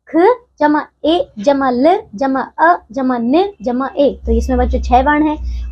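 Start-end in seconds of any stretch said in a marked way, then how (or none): 0.1-4.2 slow, letter-by-letter spelling. Shown as a demonstration. Teacher to pupils, spelling the word slowly. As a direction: spell each sound out slowly for the children. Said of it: "जैसे कि बच्चों खेलने शब्द में क्या है".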